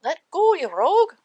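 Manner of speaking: high, loud yell down a hole. A narrator's reading. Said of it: "the little man yelled down the hole".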